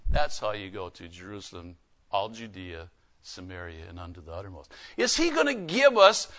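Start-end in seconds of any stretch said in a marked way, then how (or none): 0.1-4.7 much lower and much softer, almost breathed; hushed repeat. Acted. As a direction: drop to a near hush after the shout. Loud listing then whispered repeat.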